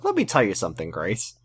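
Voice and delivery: low, soft Ben voice. Retold or imitated. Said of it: "I'm talking in Ben voice".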